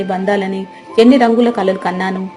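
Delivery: plain reading voice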